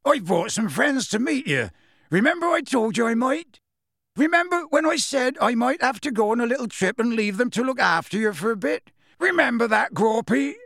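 booming gamekeeper calling up high; Hagrid voice. A narrator's reading.

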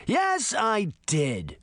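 high, very slow smug confession; gloating spider boy. Acted. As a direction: savour every word like a proud villain. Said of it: "Toby said".

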